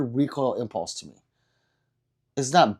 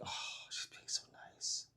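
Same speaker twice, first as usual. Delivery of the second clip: whisper; 22 dB quieter than usual. Retold or imitated. Retold thought at the stall.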